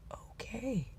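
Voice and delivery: a soft breathy whisper, barely voiced; whispered okay. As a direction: whisper it so you don't wake her. Whispering so sleepy wife rests.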